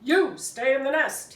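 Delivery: commanding. Acted. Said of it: "he told all of us".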